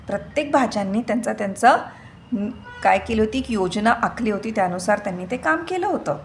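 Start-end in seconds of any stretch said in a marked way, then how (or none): none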